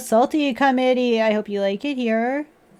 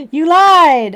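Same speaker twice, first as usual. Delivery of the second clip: louder mock accusing cry; playful accusation; 4 semitones higher, 8 dB louder than usual. Real, not acted. Teasing a viewer about the THC joke, louder playful accusation. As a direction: call them out, teasing.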